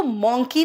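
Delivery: मंकी as "mon-kee" with rounded o; mispronunciation of monkey. Shown as a demonstration. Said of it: "इसको मंकी नहीं कहते". (मंकी को गलत "मॉन्की" बोलें)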